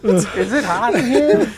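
high, slow gnome with laughter behind it. Pretend. Toy gnome asks about the room, high slow put-on voice. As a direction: pipe it up slowly as the gnome.

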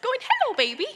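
put-on sing-song high voice for a baby; high-pitched baby talk. Grown-up greeting a little baby, high-pitched. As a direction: coo it up high, as to an infant. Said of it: "in a high-pitched voice".

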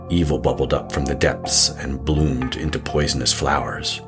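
ordinary talk, nothing marked

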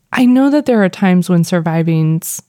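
usual speaking voice

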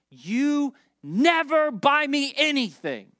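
high, loud, drawn-out mock whine; emotional kid's outburst. Son refused a Switch game by dad, mock emotional outburst. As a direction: imitate a child's aggrieved protest, stretched out. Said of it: "always use the language of never and always when they're emotional".

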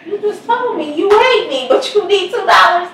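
high, loud, teasing disbelief at her son; mock exasperation. Mother teasing her son who wants two dollars, high mock exasperation. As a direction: needle him like an indignant mom.

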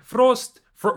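Frost said high and loud with a Russian accent. Shown as a demonstration. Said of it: "I said frost, like I'm reading the Russian".

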